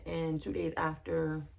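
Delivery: ordinary talk, nothing marked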